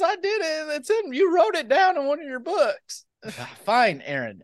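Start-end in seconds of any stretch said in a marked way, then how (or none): 0.0-3.0 put-on whiny kid brother. Kid brother protesting in skit.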